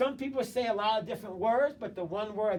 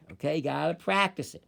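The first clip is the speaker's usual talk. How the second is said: ordinary talk, nothing marked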